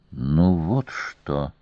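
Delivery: low, slow, contained fury; infuriated. A narrator's reading. Queen turns on the master guard, slow and infuriated. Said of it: "разъярённо начала она после короткой паузы".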